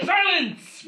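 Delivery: high, loud villainous outburst; mock-imperious yell; exclaimed command. A narrator's reading. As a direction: shout it suddenly, hammed up. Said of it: "Brian exclaimed".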